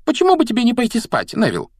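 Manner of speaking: high, fast reassurance; gabbled, over-quick denial; hurried gabbling. A narrator's reading. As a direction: rattle it off in a high girl's voice. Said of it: "затараторила Гермиона".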